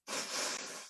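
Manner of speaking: long breathy sigh out; voiceless releasing sigh; audible out-breath. Shown as a demonstration. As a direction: let a deep cleansing breath go. Models deep breaths in meditation.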